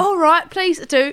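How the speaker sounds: slow, high mock Leeds accent. Customer accepting another shirt, mock Leeds accent. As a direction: draw it out, loud, in a Leeds accent. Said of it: "do the accent".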